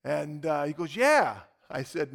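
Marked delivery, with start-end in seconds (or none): 1.0-1.4 high, loud retold "yeah"; unabashed yes. Gym guy confirming his request to preacher, loud and unabashed. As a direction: pop it out, pitched well up.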